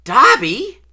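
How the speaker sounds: high, loud, rising exclamation; startled recognition. A narrator's reading. Boy spotting the house elf in the dark, startled rising cry. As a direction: cry out in astonished question.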